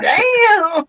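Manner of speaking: high, drawn-out mock outcry; stung exclamation. Woman retelling her reaction to the mailman's snub, stung. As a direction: exclaim it high and long, half laughing.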